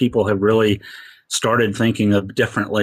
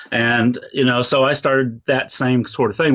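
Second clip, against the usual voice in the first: usual speaking voice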